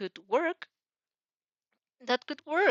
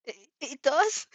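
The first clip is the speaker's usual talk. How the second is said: flustered stammer, higher; 5 semitones higher, 4 dB louder than usual. A narrator's reading. Boy flustered by the compliment, stammering. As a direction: trip over the first word. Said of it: "he stammered".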